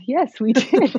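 a real laugh breaking into the answer; bright laughing confirmation; laughing yes. Real, not acted. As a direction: giggle through the reply about meeting her husband. Asked about meeting husband.

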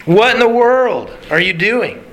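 high-pitched, incredulous warning; acted disbelief, pitched well up; mock alarmed onlooker. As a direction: imitate a sibling watching trouble brew. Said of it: "we're looking at Jonah going like".

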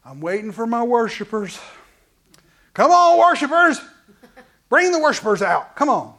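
loud, high-pitched summons to lazy worshipers; mock God voice shouting for his worshipers; impatient God calling. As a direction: call them like an impatient king on his throne. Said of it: "the Father's not sitting up there on the throne going".

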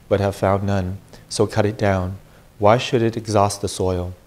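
Gospel reading. Acted. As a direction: read scripture to the congregation. Proclaims the Gospel at Mass.

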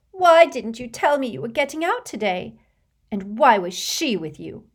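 put-on high, loud, accusing girl's voice; a jealous girl demanding answers; jealous girlfriend. A narrator's reading. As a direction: girlfriend confronting her boyfriend, high and loud. Lavender confronting Ron.

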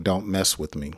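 low, soft and firm; quiet firm warning. Shown as a demonstration. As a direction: say it low under your breath. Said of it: "So you're expressing your anger by saying".

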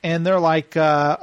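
usual speaking voice

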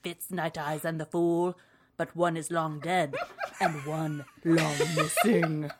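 theatrical blurb reading, lively and dark; dramatic audiobook voice. Pretend. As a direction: read it like an audiobook narrator. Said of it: "I did it in a little bit of a voice".

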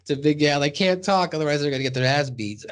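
ordinary talk, nothing marked

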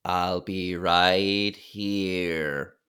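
slow, strange croaking E.T. voice, drawn out; E.T. mutter. Retold or imitated. Host imitates E.T. in a slow alien mutter. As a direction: mutter it like the little alien saying goodbye. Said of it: "he mutters the immortal line".

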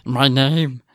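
acted bewildered prisoner; frightened prisoner. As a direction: voice a tormented captive caught off guard. Acting tormented prisoner in reading.

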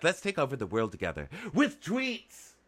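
high-pitched pitch rising to a squeak; mock eager contestant. Imitating a social media contestant's proposal, hammed-up squeaky eagerness. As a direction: squeak the last words with silly eagerness.

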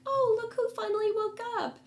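very high, put-on woman's voice; high-pitched mock quote; mimicked cellmate. Voicing a woman in the cell.

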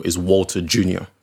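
normal talking voice

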